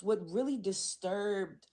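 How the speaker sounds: fighting back tears mid-sentence; choked up. Real, not acted. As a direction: voice catching with emotion. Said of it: "I needed a whoop".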